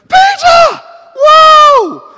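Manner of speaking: very high, loud shout of surprise; the prayer meeting shouting at the sight of Peter; joyful shout. Acted. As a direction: scream his name in delight. Crowd sees Peter at door.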